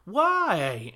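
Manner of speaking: shocked high Zach?, voice shooting up; can't believe it's him; incredulous surprise. Retold or imitated. Girls react to Zach as replacement, shocked high disbelief. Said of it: "the girls are like".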